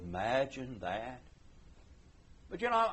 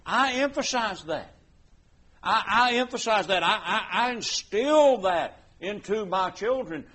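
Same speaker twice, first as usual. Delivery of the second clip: louder, fervent pulpit delivery; preaching at full force; 3 semitones higher, 6 dB louder than usual. Acted. Preacher urging the congregation to gather, full preaching force. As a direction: drive the scripture home.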